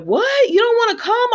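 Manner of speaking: high, taken-aback question, pitched well up; mock disbelief, voice leaping up high; retold surprise. Dad reacting to his son turning down the visit, retold surprise.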